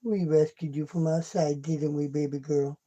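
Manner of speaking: ordinary delivery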